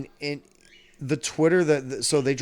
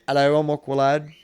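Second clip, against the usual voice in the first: put-on English accent; 5 semitones higher, 6 dB louder, faster than usual. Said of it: "he'd have, like, an English accent".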